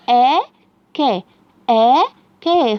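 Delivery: very slow آ، ك with loud clear syllables; syllables drawn out. Shown as a demonstration. Teacher reading syllables slowly with the child. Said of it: "يلا نقراهم مع بعض".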